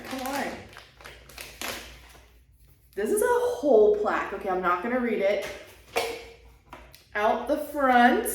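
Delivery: ordinary talk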